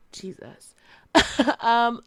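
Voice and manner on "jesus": whisper. Real, not acted. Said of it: "As I sit here and twirl my hair".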